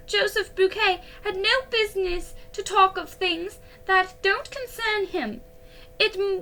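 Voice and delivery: high, loud, tearful outburst; a young girl crying as she protests; high tearful girlish wail. A narrator's reading. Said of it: "little Girlie began to cry".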